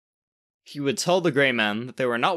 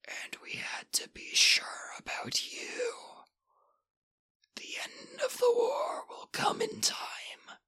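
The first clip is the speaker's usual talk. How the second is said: slow rasping whisper of an ancient creature; ancient dusty rasp; 10 dB quieter, slower than usual. Acted. Ancient creature warning the boy, slow rasping whisper. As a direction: breathe it out like dust from a tomb.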